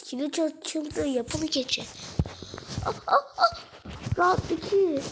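usual speaking voice

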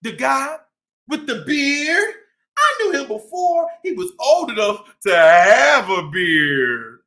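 lively shopkeeper cat telling his story; boastful bodega-boss cat, upbeat; cat narrator Chip. Acted.

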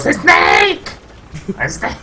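high shouted call of Snake; slightly wrong copied voice. Retold or imitated. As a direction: copy the game voice, shrill and off. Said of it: "Oye, estoy imitando la voz".

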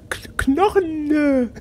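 high, laughing mock of her regional accent on Knochen. Teasing Claudia's regional way of saying Knochen, laughing mock accent. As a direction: tease her dialect on Knochen while laughing. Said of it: "nur über deinen schönen Regiolekt".

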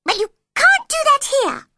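very high, loud squeaky scolding; cross Dormouse. A narrator's reading. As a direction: scold in a tiny animal's piercing voice. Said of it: "said the Dormouse crossly".

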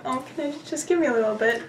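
ordinary talk, nothing marked

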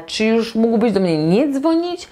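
ordinary talk, nothing marked

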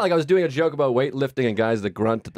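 ordinary delivery

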